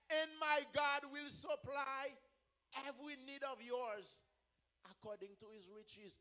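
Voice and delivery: slow, deliberate verse with long pauses; measured preacher's recitation; slow scripture recitation. Real, not acted. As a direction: give out the verse phrase by phrase.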